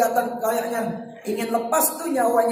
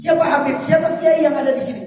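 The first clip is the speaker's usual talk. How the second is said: usual speaking voice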